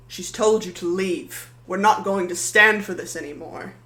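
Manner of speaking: gruff. Acted. Blacksmith steps forward to back up Maddie, gruff and loud. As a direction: plant your feet and bark it out. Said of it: "he gruffly goes".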